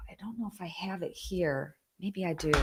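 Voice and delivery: whispered to herself, unvoiced. Real, not acted. Vlogger whispering to herself while looking for the book, whispered. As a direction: murmur it under her breath as she searches. Searching for item on stream.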